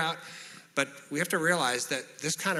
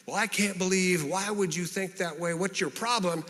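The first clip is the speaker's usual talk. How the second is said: higher-pitched defensive retort; 5 semitones higher than usual. Acted. Answering someone who says they were hurt, defensive. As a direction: act out someone getting defensive. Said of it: "you're not gonna respond defensively".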